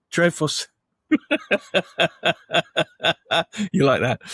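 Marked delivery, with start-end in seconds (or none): 1.1-3.7 long, high peal of laughter; helpless laugh. Real, not acted. Co-host cracks up reading the cast list, long laugh. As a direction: burst out laughing and keep it going. Laughing at his [name] joke.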